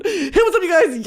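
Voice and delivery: a hint of a bubbly vlogger hello; Shane Dawson greeting. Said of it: "I morph into Shane Dawson".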